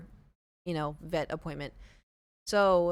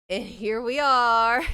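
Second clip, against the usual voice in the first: drawn-out punchline; 5 semitones higher, 10 dB louder, much slower than usual. Real, not acted. Punchline about sleeping in.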